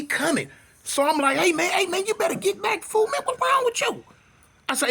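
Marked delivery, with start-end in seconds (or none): 1.4-4.0 high-pitched, climbing protest; retold frantic back-off warning; mock panic. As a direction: plead in alarm, voice rising higher and higher.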